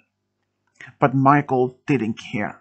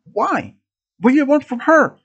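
high-pitched worried question; anxious questioning; 10 semitones higher than usual. A narrator's reading. Student asks gunman about Lisa, anxious questioning. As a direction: ask it high and urgently.

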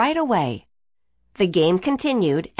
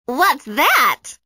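curious imp voice; 6 semitones higher, 5 dB louder than usual. A narrator's reading. Villain curious.

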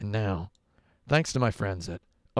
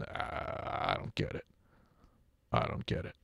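whispered, as the puzzled big brother; mock brother whisper; 9 dB quieter than usual. Brother grumbling about the new Star Wars, in a whisper. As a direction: breathe it out like a baffled brother.

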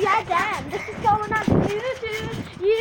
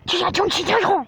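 mock Chinese accent; 6 dB louder than usual. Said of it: "Okay, do your Chinese".

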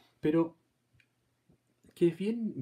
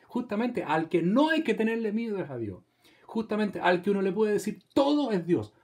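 higher and louder preaching push; rising emphatic sermon delivery; 6 semitones higher, 5 dB louder than usual. Acted. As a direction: press the point home to the congregation. Preacher raising his voice.

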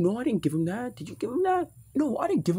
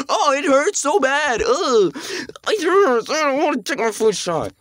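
hammed-up whining, higher and louder; mock whimpering patient; mocking whiny kid; 9 semitones higher, 7 dB louder, slower than usual. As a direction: mimic the crybaby to mock him. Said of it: "because you keep going".

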